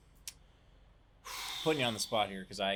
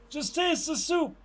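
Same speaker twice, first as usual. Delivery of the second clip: movie quote; 7 dB louder, slower than usual. Retold or imitated. Louder, slower retake.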